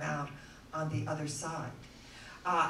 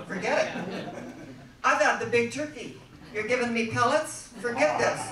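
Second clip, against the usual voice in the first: a higher, louder, indignant dog who wants turkey; huffy dog voice; 4 semitones higher, 6 dB louder than usual. Acted. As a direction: play the spoiled pet turning up his nose. The dog refusing dry food.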